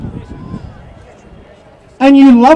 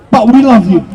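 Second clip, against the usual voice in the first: a lower, sneering "but we love you"; mock caring; 7 semitones lower, faster than usual. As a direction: parody their fake affection, dropping the voice. Mocking the authorities' fake care.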